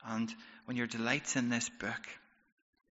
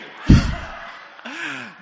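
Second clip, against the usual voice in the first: hearty laugh; 15 dB louder than usual. Real, not acted. Laughs at own joke.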